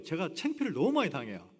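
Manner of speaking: normal talking voice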